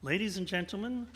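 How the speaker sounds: ordinary talk, nothing marked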